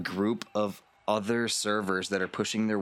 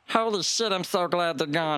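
much higher, relieved rush; panicked real self; 9 semitones higher than usual. Acted. Disguised figure confessing to Lance once the door shuts, much higher. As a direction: drop the act in frantic relief. Disguised character drops the act.

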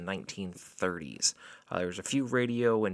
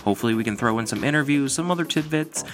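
ringmaster; 6 dB louder than usual. Acted. Podcast intro, showman welcome.

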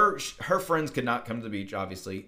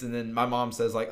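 ordinary talk, nothing marked

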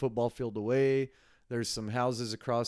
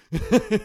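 a quick, high, loud laugh; short laugh; 10 semitones higher, 9 dB louder than usual. Real, not acted. Joking about being too full, short laugh. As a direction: laugh at yourself.

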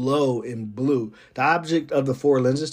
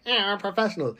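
higher-pitched mock dismissal; mock professional; 7 semitones higher, faster than usual. Mimicking military people acting like it's not murder, mock dismissive. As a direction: lightly mimic a brush-off. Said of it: "we try to act like it's not".

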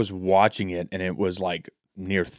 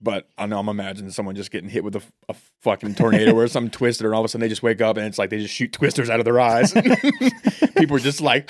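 ordinary delivery

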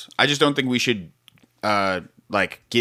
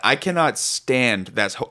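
normal talking voice

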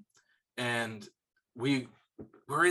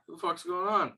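higher-pitched puzzled animal voice; bewildered buck; 6 semitones higher than usual. Retold or imitated. Buck looking around mid-gunfire, lightly voiced bewilderment. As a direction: lightly voice a confused deer.

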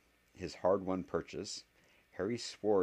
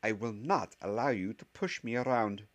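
stern, higher-pitched warning; tinge of danger; 6 semitones higher, 4 dB louder than usual. A narrator's reading. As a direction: put your foot down as a professor. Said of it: "said with a tinge of danger".

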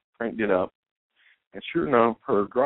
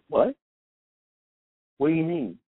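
higher, sudden worried what; alarmed surprise; 8 semitones higher than usual. Retold or imitated. Woman hearing her boyfriend is coming, alarmed. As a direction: caught off guard by bad news. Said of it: "her tone changes".

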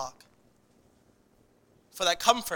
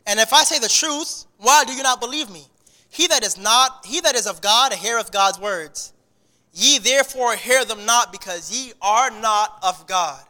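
raised, solemn Bible reading; scripture reading; 3 semitones higher, 5 dB louder than usual. Acted. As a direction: read the verses out with weight. Reads scripture aloud.

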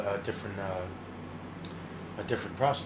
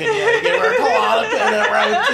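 very high, giggling through words; laughing; 6 dB louder than usual. Real, not acted. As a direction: crack up while telling it. Laughing while retelling.

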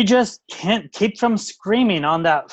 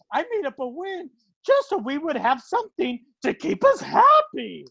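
ordinary talk

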